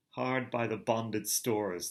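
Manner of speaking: plain reading voice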